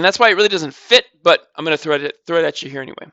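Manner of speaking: normal talking voice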